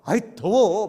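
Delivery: loud, whiny "too hot" from a wife; loud exasperated sigh of heat; mock complaint. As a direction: huff about the heat like an overheated wife.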